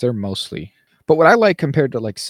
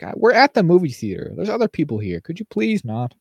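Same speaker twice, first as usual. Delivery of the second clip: annoyed; 7 semitones higher than usual. Retold or imitated. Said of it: "It's like".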